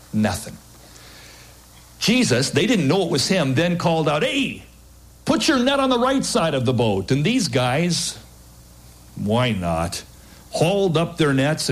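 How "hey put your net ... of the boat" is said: high call to the boat. Acted. Jesus calling to the fishermen, high call. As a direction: shout the order across the water.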